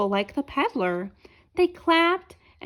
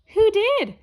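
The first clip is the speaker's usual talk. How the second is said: high, louder puzzled question; lightly voiced tumbler, curious; high eager asking; 9 semitones higher, 5 dB louder than usual. A narrator's reading. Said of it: "asked the tumblers".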